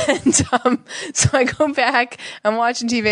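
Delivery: ordinary delivery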